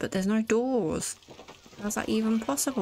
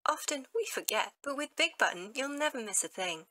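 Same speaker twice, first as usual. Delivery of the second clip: mock announcer; 3 semitones higher, 3 dB quieter than usual. The player reads a mock subscribe reminder to viewers, announcer style. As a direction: parody public announcement, soft and high.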